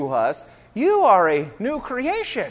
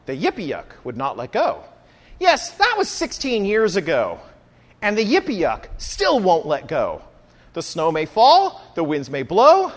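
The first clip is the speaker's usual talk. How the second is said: measured storybook verse with pauses, refrains dropping low; poem recitation; 5 semitones lower than usual. Acted. Preacher reading a [name] poem to the congregation, dramatic recitation. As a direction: recite it like a comic tale, line by line.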